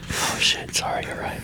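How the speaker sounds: quick hushed apology; whisper, scared of being heard; frantic whisper. Pretend. Hiding, whispering.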